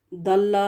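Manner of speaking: ضل said as "dal-la" with light d; thin Daal opening ضل; mistaken Daal. Shown as a demonstration. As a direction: say ضل slowly with plain tongue-tip d. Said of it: "جو دال کے ساتھ".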